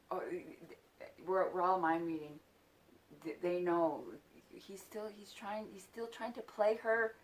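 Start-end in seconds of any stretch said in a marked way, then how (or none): none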